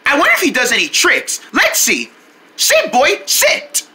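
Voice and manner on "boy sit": plain reading voice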